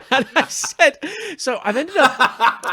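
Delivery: breaking into high laughter mid-sentence; laughing. Real, not acted. Co-host hears him laugh at his own costly switch, laughing high. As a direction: laugh through the words, pitch jumping up.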